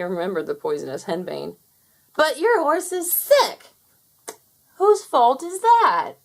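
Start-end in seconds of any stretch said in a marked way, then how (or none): 2.2-3.7 high, loud, slow dismay; panicked prince crying out; alarmed. Acted. Fowler realising the trick. 4.8-6.1 high, loud, slow gloating; victorious brother taunting; triumphant retort. Acted.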